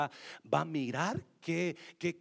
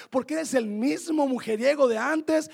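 angry ex; 9 semitones higher, 11 dB louder than usual. Acted. Ex keeps accusing him.

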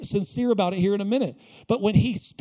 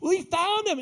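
very high, eager cry; thrilled squeal of discovery; excitement through the roof; 11 semitones higher than usual. Acted. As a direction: burst out with the news, pitch way up. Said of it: "his excitement was through the roof".

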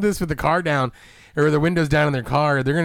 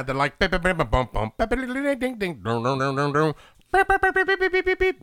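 imitating electronic beeps; 4 semitones higher than usual. Imitating a genre-hopping song.

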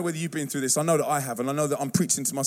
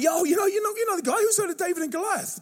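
much higher, pleading re-enactment; incredulous asking. Retold or imitated. Asking teens about David and Goliath again, incredulous. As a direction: pitch it right up, urging them to remember.

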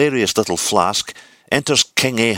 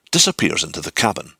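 ordinary delivery, nothing marked

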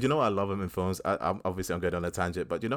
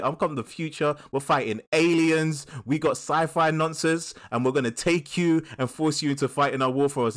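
slightly hammed-up announcement, pitched higher; lightly voiced future recruiter; 6 semitones higher, 4 dB louder than usual. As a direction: proclaim it like a visitor recruiting for a war. Acts out visitor from the future.

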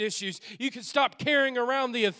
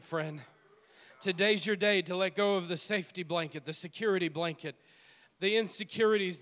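gentle altar call. Acted. Pastor inviting the standing congregation to the altar, gently. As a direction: coax them back to praise.

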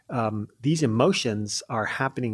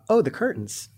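casual, higher-pitched passing remark; innocuous remark; 6 semitones higher than usual. Retold or imitated. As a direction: say it offhand, as a harmless observation. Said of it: "She said it pretty much in that tone of voice".

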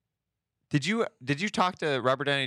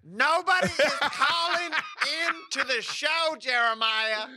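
high, slow, exasperated; imitating Eric; much slower than usual. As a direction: spell it out, annoyed and slow. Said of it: "And you're like".

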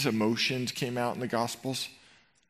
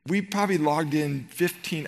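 higher and louder, disbelieving; exasperated retelling; 5 semitones higher, 4 dB louder than usual. Retold or imitated. Youth pastor to camper Gary, exasperated and loud. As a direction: vent mock outrage at the complaint.

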